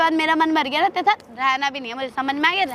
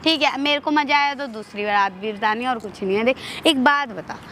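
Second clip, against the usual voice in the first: sweet coaxing; 6 semitones lower than usual. Acted. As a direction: talk him round gently in a low voice. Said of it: "प्यार से समझा रही हूँ".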